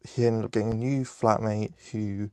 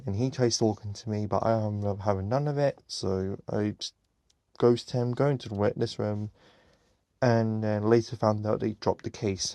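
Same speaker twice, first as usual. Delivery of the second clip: ordinary talk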